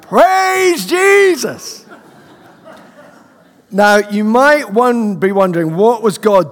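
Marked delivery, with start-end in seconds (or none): 0.0-1.8 much higher, drawn-out shout of praise. Retold or imitated. The student finally shouting praise in public. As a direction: belt it out across the room. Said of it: "I was having to shout".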